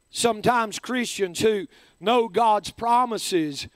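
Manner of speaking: ordinary talk, nothing marked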